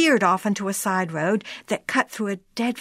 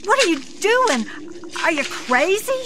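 frightened scream; 10 semitones higher than usual. A narrator's reading. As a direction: shriek it in alarm, as if he's lost his mind. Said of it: "she screamed".

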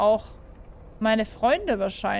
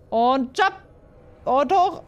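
higher, louder cheering on the jump; excited jump call; 4 semitones higher, 5 dB louder, slower than usual. Real, not acted. Gamer jumping a gap, higher louder excited call. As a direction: urge yourself over the gap.

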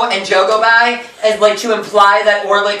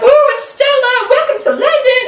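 much higher, lovestruck voice; high swooning Pip. Retold or imitated. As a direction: go way up like a smitten fool. Pip voiced greeting Estella.

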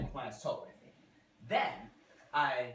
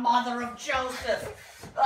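laughing exclamation; 6 semitones higher, 9 dB louder, much slower than usual. Real, not acted.

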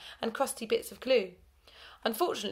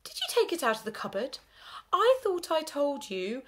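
rising, slowing reproach; teacher catching pupils out; suspicious teacher; 7 semitones higher than usual. A narrator's reading. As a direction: narrow your eyes and accuse. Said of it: "Her eyes narrowed".

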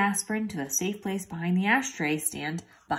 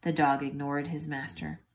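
plain reading voice

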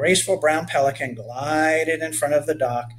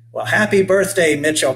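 loud, lifted birthday greeting; exclaiming; 4 semitones higher, 6 dB louder than usual. A narrator's reading. Captain to the birthday boy, exclaiming. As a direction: exclaim it heartily, starting high and loud. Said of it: "Captain Carl exclaimed".

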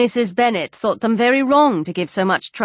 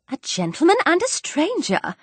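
high, thrilled "a gentleman and a stranger"; excited echo; 8 semitones higher than usual. Acted. Wife seizing on her husband's news, high and excited. Wife echoes him, eyes sparkling.

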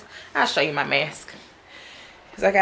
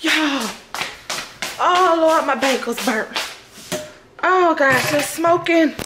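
alarmed; 8 semitones higher, slower than usual. Real, not acted. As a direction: cry out in panic at the smoking pan. Bagels burning on the stove.

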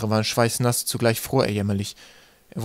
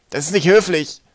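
high, loud reproach; indignant scolding; 7 semitones higher, 7 dB louder than usual. A narrator's reading. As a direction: tell them off with offended indignation. Scolded stranger continues his telling-off.

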